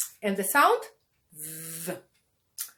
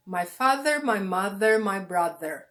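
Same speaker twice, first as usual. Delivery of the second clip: ordinary talk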